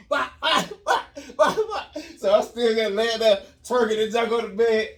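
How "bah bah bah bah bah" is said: loud high bah noises through laughter; belt smacks. Retold or imitated. Imitating the belt, loud and laughing. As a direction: smack out the hits.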